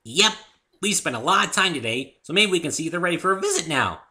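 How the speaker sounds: very loud, high, fast and building; hyper Pinkie. Acted. Pinkie rushes Dave off to meet Twilight, hyper and loud. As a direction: zoom off with toy car noises.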